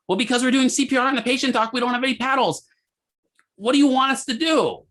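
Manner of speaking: high-pitched, harried arguing back; exasperated retort. Retold or imitated. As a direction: imitate flustered staff protesting to the doctor. Staff arguing back at yelling doctor.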